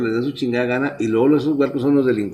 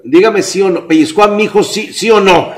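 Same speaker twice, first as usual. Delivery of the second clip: loud, higher, insistent demand; demanding father; 7 semitones higher, 5 dB louder than usual. Retold or imitated. Father confronts the teacher about the pinch, loud and angry. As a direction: press her for a yes or no.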